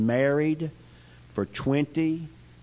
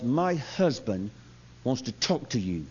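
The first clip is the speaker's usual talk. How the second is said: slow, low, quiet and icy; measured words from a wife whose looks could kill; quietly seething, spaced-out delivery; icy displeasure; 3 semitones lower, 5 dB quieter than usual. Retold or imitated. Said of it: "if looks could kill".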